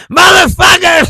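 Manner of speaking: high, loud movie-tough-guy yell; hammed-up gangster shout; mock Russian yell. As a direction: bellow it like the unkillable Russian. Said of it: "He just kept saying".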